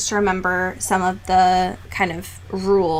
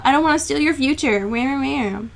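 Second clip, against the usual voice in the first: higher mock whine, trailing into baby wailing; mock his self-pity like a sulky baby; mopey; 5 semitones higher than usual. Reader mocking the brooding fiancé's self-sacrifice, mopey whine. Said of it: "starts being a mopey person".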